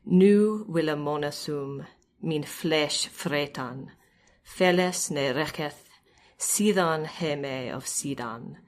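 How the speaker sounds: put-on slow, measured reading of an old riddle, each half line set apart; Old English recitation. Shown as a demonstration. Scholar reads an Exeter Book riddle aloud in slow recitation. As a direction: recite the alliterative verse slowly with pauses between half lines. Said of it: "So this is, uh, number seventy-seven".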